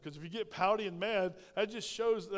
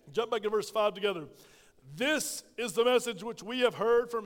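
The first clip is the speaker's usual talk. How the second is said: preaching at full force; 3 semitones higher, 6 dB louder than usual. Acted. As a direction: drive the Word home to the congregation.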